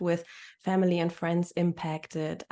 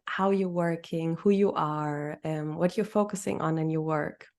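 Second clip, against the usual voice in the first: ordinary talk, nothing marked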